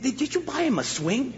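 high-pitched shop owner question. Retold or imitated. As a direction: ask it high and casual. Pet shop owner asking.

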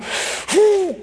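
high-pitched blown-out breath; deflating exhale. Acted. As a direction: let all the anger out in one puff. Deflated reaction of the angry husband.

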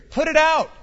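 high, loud narrated cry; alarmed cry. A narrator's reading. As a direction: cry it out as an urgent order. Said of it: "they cried".

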